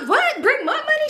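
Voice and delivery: high-pitched incredulous outburst, acted out; squeaky retold "what?!"; mock disbelief. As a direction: play baffled and caught out, voice shooting up. Acting out caught-out woman on phone.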